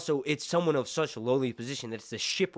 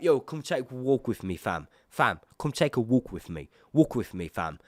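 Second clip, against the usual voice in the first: put-on British accent, casual and coaxing; British-accented goading, phrase by phrase; British Daemon impression. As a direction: play a swaggering British tough inviting someone for a walk. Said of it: "when I do a British accent".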